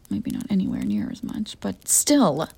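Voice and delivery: whispered admission. Acted. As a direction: concede it under your breath. Muttered admission.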